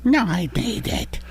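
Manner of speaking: put-on high little boy voice; kid voice. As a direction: play a sweet little boy. Child voice.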